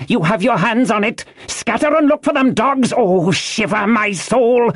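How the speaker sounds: high, raging plea; frantic cry. A narrator's reading. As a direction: urge them on with desperate high yells. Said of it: "he cried".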